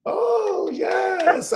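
high, excited cry of delight. Real, not acted. Host delighted when the guest says happy birthday. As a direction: squeal it out, thrilled.

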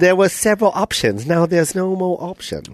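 put-on Chinese accent monologue; mock Chinese accent. Restaurant worker's inner monologue about phone options, mock Chinese accent. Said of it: "You get to do that accent".

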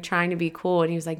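ordinary delivery

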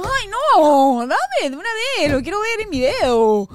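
high, loud whining plea; eager pleading. Retold or imitated. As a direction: beg to see it now, like a kid. Wife begging for the gift.

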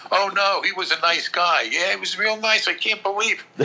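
high-pitched imitation of shocked neighbours; mock disbelieving bystander. Quoting shocked neighbours after a shooting.

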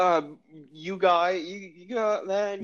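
put-on high, halting British accent, words stretched out. Can't do Australian, so plays him in a British accent. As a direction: fumble slowly through a put-on British voice. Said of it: "doing a British accent".

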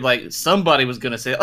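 ordinary delivery

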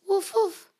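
high yappy puppy woof; puppy bark. Acted. Reading Biscuit to children, high puppy woof. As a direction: bark it like a little dog. Dog bark voiced in story.

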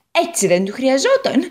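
an eager little potato telling her woes; Potato character voice. Acted. Potato speaks.

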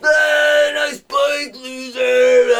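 high, jeering shout; hammed-up street heckle; imitated heckling yell. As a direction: yell it like mocking bikers. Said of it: "And they're yelling at him".

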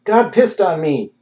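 slow, high, loud cry of revelation; yelling. A narrator's reading. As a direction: shout it out, each word drawn out. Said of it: "he kept on yelling at people".

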